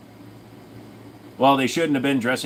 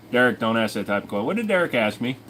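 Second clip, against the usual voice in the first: ordinary delivery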